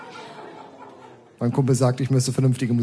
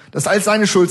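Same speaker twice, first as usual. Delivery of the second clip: put-on higher, louder childish accusation; little girl; 7 semitones higher, 5 dB louder than usual. Little girl blaming the narrator, higher and louder. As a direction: point the finger like a pouty kid. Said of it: "sagt das kleine Mädchen".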